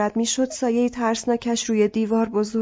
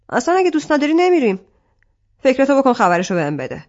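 angry dismissal; 3 semitones higher, 6 dB louder, faster than usual. Acted. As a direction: push her away in anger. Arsalan angrily backs off.

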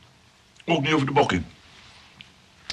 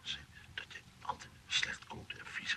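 soft whisper, passing on nasty gossip; 2 semitones higher, 12 dB quieter than usual. Acted. Johan whispering the staff's gossip to Clivia. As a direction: whisper it like a secret.

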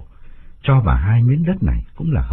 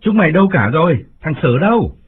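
louder, higher call to the servants; calling out loudly; 7 semitones higher, 4 dB louder than usual. A narrator's reading. Master calls loudly for his servants. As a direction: shout for the household. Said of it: "gọi lớn".

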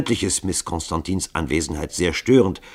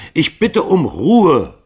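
laut, hoch und langsam gebrüllt; 8 semitones higher, 8 dB louder, much slower than usual. Acted. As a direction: die Runde laut zur Ruhe brüllen. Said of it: "brüllte Hall sie an". (laut brüllend)

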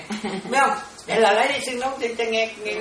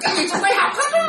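high laughing exclamation; 4 dB louder than usual. Real, not acted. Nun to disciples, laughing high about studying everything. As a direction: laugh it out, pitch jumping up.

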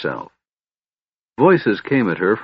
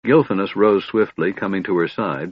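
plain reading voice

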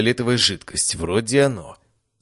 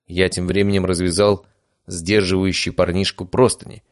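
plain reading voice

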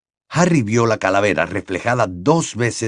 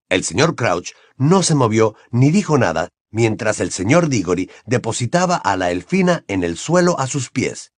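ordinary talk, nothing marked

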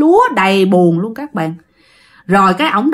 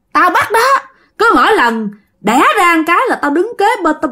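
piercing ghost voice; 6 semitones higher than usual. Retold or imitated. Ghost Liễu confesses to the family, high and piercing. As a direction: let the vengeful spirit ring out high. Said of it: "Nói lanh lảnh luôn".

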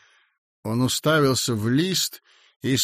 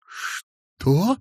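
joyful disbelief; 6 semitones higher than usual. A narrator's reading. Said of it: "не помня себя от радости".

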